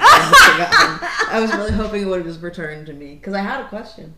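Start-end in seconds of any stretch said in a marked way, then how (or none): 0.0-1.3 laughing, loud and high. Real, not acted. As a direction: giggle through the words. Laughs at forgetting question.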